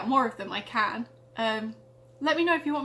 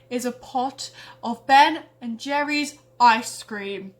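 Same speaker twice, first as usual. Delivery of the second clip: slow reveal; 8 dB louder, slower than usual. Acted. Fridge reveals treasure.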